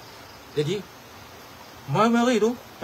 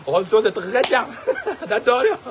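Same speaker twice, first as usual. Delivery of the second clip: real laughter breaking into the words; laughing; 4 semitones higher, 3 dB louder than usual. Real, not acted. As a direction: tell it cracking up, higher. Cracks up telling of the kick.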